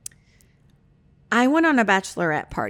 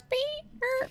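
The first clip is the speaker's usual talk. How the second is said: high, soft vocal beep; imitated beep; 11 dB quieter than usual. Friend playing at reversing the conversation, high imitated beep. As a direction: squeak out a tiny reversing beep.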